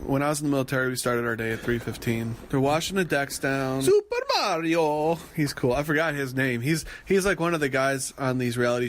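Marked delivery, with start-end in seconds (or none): 3.8-5.2 playful announcer. Pretend. Announcing crew member playfully.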